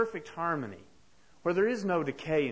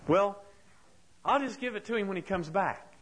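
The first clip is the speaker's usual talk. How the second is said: lightly voiced childlike Big Bird, higher and brighter; a hint of an innocent big bird, hopeful; 5 semitones higher than usual. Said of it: "and Big Bird looks at the picture and he says".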